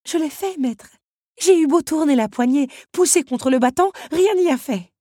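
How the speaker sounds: high, loud, fast fretting; worried teapot. A narrator's reading. Madame Samovar telling the master Belle is missing, high and fast. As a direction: rush the worry out.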